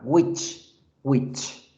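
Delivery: "Which" said exactly like English "witch"; which pronounced like witch. Shown as a demonstration. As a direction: demonstrate "which" as a lone word, sounding like "witch". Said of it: "pronunciación de which igual que la palabra bruja".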